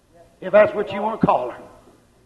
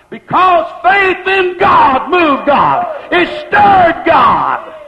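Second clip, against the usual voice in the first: slow, high, loud proclamation; full-force preacher's cry; preaching shout; 5 semitones higher, 4 dB louder, much slower than usual. Real, not acted. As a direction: shout it out slowly to the congregation. Preacher building to a climax.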